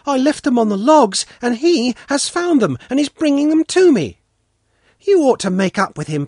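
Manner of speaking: put-on high, loud, eager child voice; joyful cry. A narrator's reading. Svetlana urging Pashka about Sanka, high happy child voice. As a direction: gush like a delighted little girl. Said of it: "Svetlana cried joyfully".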